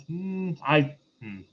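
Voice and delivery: ordinary talk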